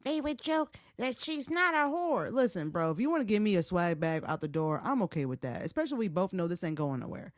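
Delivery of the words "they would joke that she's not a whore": mocking female listener. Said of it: "female listeners who are like".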